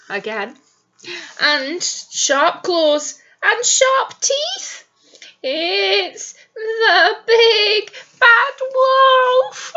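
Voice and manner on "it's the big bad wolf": high slow terrified wail; scared pig voice. Acted. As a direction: wail it in fright. Frightened pig sees wolf.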